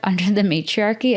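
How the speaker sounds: ordinary talk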